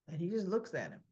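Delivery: ordinary talk, nothing marked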